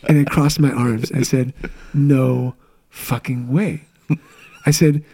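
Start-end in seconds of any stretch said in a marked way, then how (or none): 1.9-3.8 drawn-out, slow refusal to believe; retold disbelief. Husband retelling his reaction to the pregnancy test, slow disbelief. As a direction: stretch each word out in stunned disbelief.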